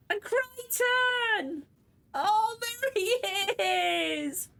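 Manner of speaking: Crichton stretched long and high; excited cry. Real, not acted. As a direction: call his name like a reunion. Fan greets returning character.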